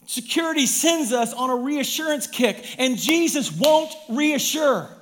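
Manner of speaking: high, loud, emphatic pulpit voice; forceful preaching. Real, not acted. Preacher pressing the congregation about reassurance, forceful preaching. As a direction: drive each question home.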